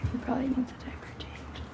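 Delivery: soft whisper to herself; whispered aside. Real, not acted. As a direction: murmur it under your breath, not to wake the room. Aside while caring for baby.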